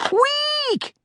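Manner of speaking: tiny, very high mouse squeak; a mouse's high squeak as his tail gets clamped. A narrator's reading. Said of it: "squeaked Squeakers".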